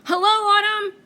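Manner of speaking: very high, loud welcoming call; very high, loud hello; grand finale. Acted. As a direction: proclaim the season's arrival. Grand closing greeting.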